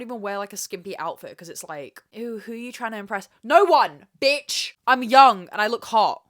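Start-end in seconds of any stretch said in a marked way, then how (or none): 3.4-6.2 mock-furious retort, much louder; defiant shout. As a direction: shout it back at the critic. Ranting against imagined critic.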